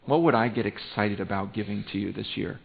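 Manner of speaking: low, soft musing to God; quiet prayer. Acted. Pastor modelling a private question to God, in quiet prayer. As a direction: pray it softly, feeling for the question. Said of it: "and ask God".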